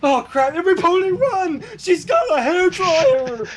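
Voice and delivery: high hammed-up alarm; mock panic. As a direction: squeal it like a fleeing pony. Ponies panic at hairdryer.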